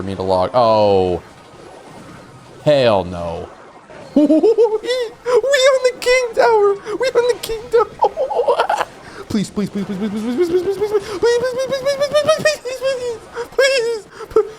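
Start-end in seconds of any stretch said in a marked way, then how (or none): 4.1-8.9 very high, slow, laughing wail. Real, not acted. Laughing wail at the tower hit. 9.3-14.5 very high, laughing, near-tearful pleading; frantic begging. Real, not acted. Begging the shot to land.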